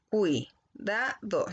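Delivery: Cuidado said slowly as cui-da-do; split into syllables. Shown as a demonstration. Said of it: "La U y la I quedan juntas".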